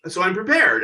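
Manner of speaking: high, fast, half-laughing insistence; exasperated Joan Rivers. Retold or imitated. Correcting the crew about the light, high and fast. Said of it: "And she goes".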